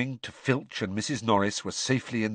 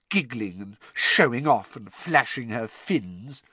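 ordinary delivery

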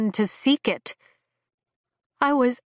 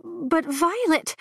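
higher, slow and pleading; young dragon voice; 8 semitones higher, slower than usual. Acted. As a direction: stretch the name out like a hurt young dragon.

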